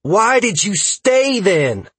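high, loud, drawn-out challenge; near shout. A narrator's reading. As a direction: demand it, almost shouting. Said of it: "Freya practically shouted".

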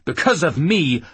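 loud, falling, hammered ME; angry retort. Acted. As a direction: throw it back at her. Man throws her words back.

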